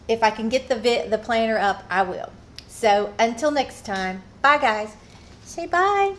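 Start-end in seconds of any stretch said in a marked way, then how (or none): 5.4-6.1 high, cutesy sing-song goodbye; baby talk to pet. Pretend. Owner has her dog say goodbye to viewers, high baby talk. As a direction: coo it high on the pet's behalf.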